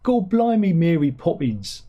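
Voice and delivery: a bad Cockney accent like a movie chimney sweep; mock Cockney accent. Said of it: "not his Cockney accent".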